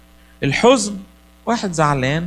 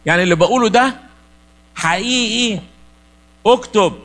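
commanding divine voice; 5 semitones higher than usual. Acted. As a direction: order it like a voice from heaven. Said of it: "ربنا قال له".

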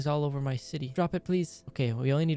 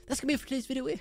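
outro sign-off; 10 semitones higher, much faster than usual. Real, not acted.

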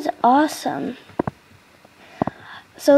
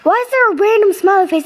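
higher, louder, fast startled question; quick alarmed burst; spooked exclaim; 8 semitones higher, 4 dB louder, faster than usual. Real, not acted. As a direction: blurt it out, spooked. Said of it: "That scares the crud out of me".

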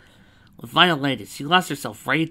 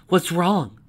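raised, louder bark; barked taunt; 6 semitones higher, 6 dB louder than usual. A narrator's reading. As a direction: bark it at your opponent. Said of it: "Lyra barked".

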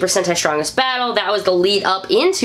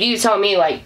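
normal talking voice

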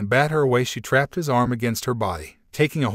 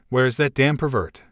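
usual speaking voice

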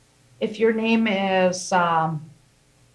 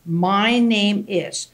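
slow demo; much slower than usual. Shown as a demonstration.

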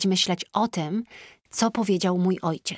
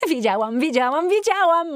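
od swobodnie do piskliwego okrzyku; każde wiedziałam coraz wyżej i głośniej; narastająca radość; 8 semitones higher, 4 dB louder than usual. A narrator's reading. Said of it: "mówiła podskakując". (Tina skacze w kółko i coraz wyżej, głośniej wykrzykuje radość)

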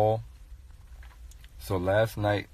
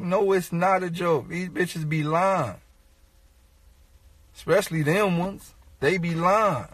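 comic outrage, voice climbing higher; indignant rant; 10 semitones higher than usual. Real, not acted. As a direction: push back like they can't believe the question.

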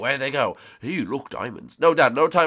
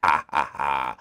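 low villain chuckle; evil laugh; 7 semitones lower, 3 dB louder, slower than usual. Acted. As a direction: chuckle darkly.

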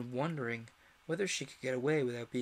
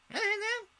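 very high shouted demand; queen demanding the heads; shouting Queen; 4 dB louder than usual. A narrator's reading. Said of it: "shouted the Queen".

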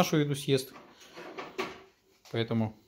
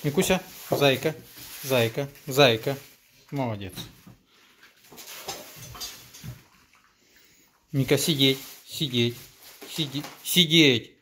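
commanding a dog; 4 dB louder than usual. Real, not acted. Coaxing the dog to sit.

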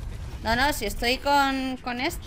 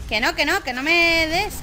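frustrated yell; 6 semitones higher, 4 dB louder than usual. Real, not acted. Frustrated at game attack.

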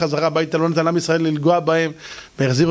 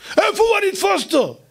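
high angry threat; angry king; 13 semitones higher than usual. Acted. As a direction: snarl it, determined to hunt him. King threatens to catch Elisha.

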